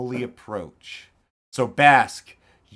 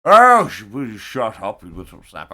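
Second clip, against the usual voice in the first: gruff man; 11 dB louder than usual. Acted. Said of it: "the gruff voice going".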